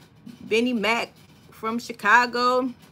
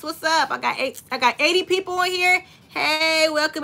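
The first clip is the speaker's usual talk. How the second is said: excited; 5 semitones higher than usual. Real, not acted. Excited by eighty viewers.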